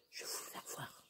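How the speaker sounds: unvoiced whisper so the dog stays asleep; whispered to viewers beside a sleeping dog. Real, not acted.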